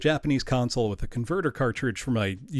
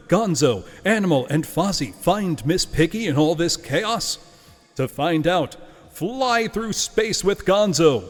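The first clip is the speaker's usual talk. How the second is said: booming trailer narrator, louder and higher; movie announcer voice; 6 semitones higher, 4 dB louder, slower than usual. Acted. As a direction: sell it like a big movie trailer. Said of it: "I will use my best movie announcer voice here".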